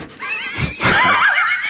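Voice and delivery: high breathless shriek of a laugh; cracking up at his own story. Real, not acted. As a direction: burst into a squealing laugh.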